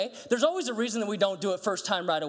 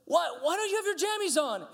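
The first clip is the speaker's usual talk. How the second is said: high-pitched exasperated parent; passionate scolding; 10 semitones higher than usual. Retold or imitated. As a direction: demand an explanation from the child. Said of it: "I'm passionate about it".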